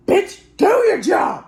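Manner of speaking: yelled, high and loud; angry shout. Acted. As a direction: bark the order furiously. Yelling at janky screen.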